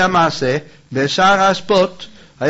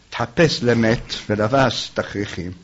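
normal talking voice

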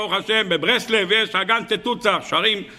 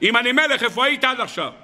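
raised high, mock-thundering rebuke; retold divine shout; 6 semitones higher than usual. God rebukes the Karliner for crying King, in a high mock shout. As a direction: call it out high like God scolding. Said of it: "הקדוש ברוך הוא צועק עליי".

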